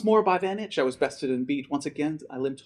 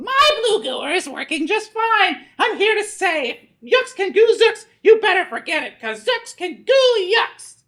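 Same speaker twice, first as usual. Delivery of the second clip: high, loud cackling taunt; gloating yell; 9 dB louder than usual. Acted. Vanitch gloats at the boy from his machine, laughing yell. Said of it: "and he yelled".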